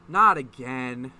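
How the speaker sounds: loud. Acted. [name] exasperated at dare.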